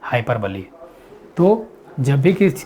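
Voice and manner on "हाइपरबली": हाइपरबली said hai-per-buh-lee, low and falling; low, four-syllable हाइपरबली ending buh-lee; correct pronunciation. Shown as a demonstration. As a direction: close हाइपरबली on a separate "lee". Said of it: "क्या पढ़ते हैं?".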